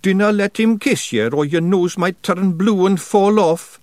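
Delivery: chirpy little Scots warrior joking; cheerful Scottish pictsie. A narrator's reading. Daft Woolly joking to Tiffany, cheerful Scottish voice. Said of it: "said Daft Woolly cheerfully".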